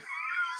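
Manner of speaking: high squeal of a laugh. Real, not acted. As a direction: let out a sharp high laugh. Laughs at the pile of sets.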